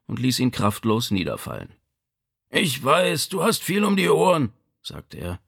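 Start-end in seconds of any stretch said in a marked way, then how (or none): 2.5-4.5 high-pitched ailing father; reproachful father's voice; Vito. A narrator's reading. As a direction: concede it with strain, pitched high. Injured father reproaches his busy son.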